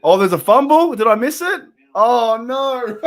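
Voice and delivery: loud, high, laughing dismay; excited reaction. Real, not acted. Fan who may have missed a fumble, loud excited reaction. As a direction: blurt it out, thrilled and dismayed.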